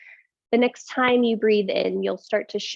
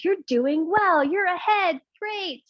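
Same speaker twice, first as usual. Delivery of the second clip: high mock praise; 8 semitones higher, slower than usual.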